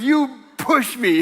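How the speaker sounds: put-on high, slow, loud child's accusation; accusing grandson. Grandson accusing grandpa after a fall, high slow child whine. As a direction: drag out the blame like a sulky kid. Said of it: "he goes".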